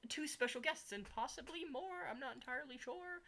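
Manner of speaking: upbeat stream host voice; streamer greeting. Acted.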